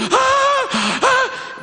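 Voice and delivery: very high, loud terrified wails; mock shrieks of a trembling demon; imitated trembling cries. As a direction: cry out as if shaking with fear. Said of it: "e ele tremia".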